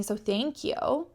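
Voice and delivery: normal talking voice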